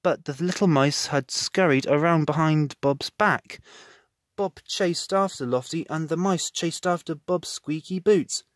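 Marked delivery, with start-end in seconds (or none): none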